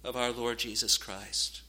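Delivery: ordinary delivery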